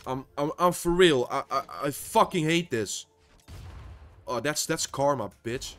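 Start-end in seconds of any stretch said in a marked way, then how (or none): 0.1-3.1 loud frustrated outburst; angry yelling. Real, not acted. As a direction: yell it in real rage. Raging at losing in game.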